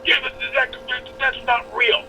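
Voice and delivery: high mocking kid voice; whiny kid. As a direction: play the sulky teen refusing the facts. Kid denying the old fight.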